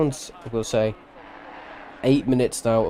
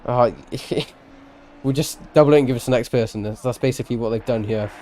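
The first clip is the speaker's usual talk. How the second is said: usual speaking voice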